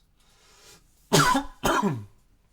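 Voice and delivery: real, loud throat-clearing cough; sudden loud cough. Real, not acted. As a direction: cough hard, twice. Loud cough between sentences.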